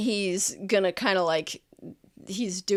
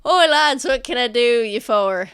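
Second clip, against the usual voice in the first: merchant greeting; 3 semitones higher, 9 dB louder than usual. Acted. Merchant hailing the approaching guards, loud and hearty. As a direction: hail the guards cheerily. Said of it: "and then Sentha goes".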